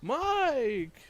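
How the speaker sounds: high, slow, drawn-out ghostly moan. Acted. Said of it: "Are you a ghost?".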